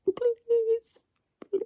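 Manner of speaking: put-on little kid pleading please; childish begging. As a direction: beg like a small child.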